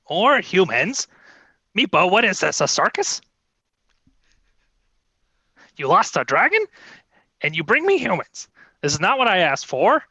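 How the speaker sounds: lightly voiced haughty queen. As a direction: scold the servant with royal disdain. Kobold queen scolds Meepo.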